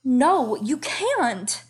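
high, loud, alarmed cry; gasping protest. Acted. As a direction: blurt it out in shock. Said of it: "Sansa heard herself gasp".

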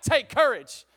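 loud, punchy congregational cue; rallying shout. Shown as a demonstration. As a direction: shout it out for the crowd to join in. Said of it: "Somebody shout".

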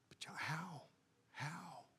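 hushed, breathless whisper of a lost seeker; whispered question. Acted. Preacher voicing the struggling listener, whispered.

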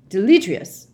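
very loud spell shout. A narrator's reading. Mister Diggory dispelling the skull, sharp loud shout. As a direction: blast the spell out. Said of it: "Mister Diggory shouted".

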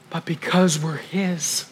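usual speaking voice

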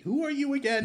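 put-on high, wavering forgetful old man. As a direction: play a confused old man.

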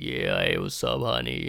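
very low hoarse pickup line; wrecked voice flirting.

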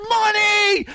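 imitating a girl's thrilled yelp; excited squeal. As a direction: squeal it like you struck gold. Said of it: "Like this".

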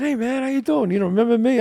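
retold friendly approach to an old colleague; eager greeting. Greets actor who knew him.

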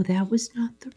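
whispered aside; whispering. Real, not acted. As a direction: whisper it like a secret. Drops voice for the reveal.

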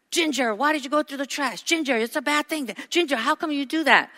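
high, exasperated owner scolding the dog. Retold or imitated. Owner telling off her dog for the trash.

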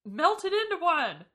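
high, loud hurt protest; indignant outburst. Retold or imitated. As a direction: cry it out, aggrieved. Acted quote from the scene.

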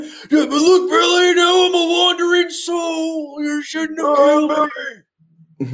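high, loud cartoon kid voice; squeaky cartoon character. Voicing a ghost grandfather.

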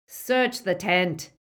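slow, loud command; barked order. Acted. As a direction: bark it out to the men. Snatcher giving an order.

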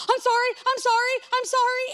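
high, rushed string of apologies; flustered apology. Retold or imitated. Guest who walked in on the cleaning lady, flustered apology. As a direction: blurt it out, mortified. Awkwardly apologizing after walking in.

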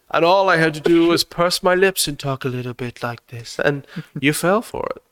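purse the lips and go posh British; posh British accent. Retold or imitated. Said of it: "posh, affected British accent".

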